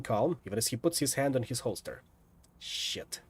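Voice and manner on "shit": breathless whispered curse; mouthed curse. Acted. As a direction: mouth it almost without sound. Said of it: "mouth the word".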